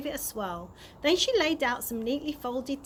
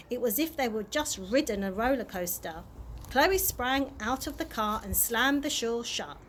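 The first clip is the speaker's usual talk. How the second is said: plain reading voice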